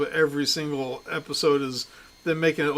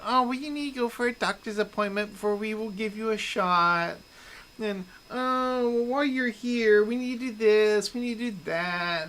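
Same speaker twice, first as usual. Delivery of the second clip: mocking pharmacy staff; 7 semitones higher than usual. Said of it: "them saying".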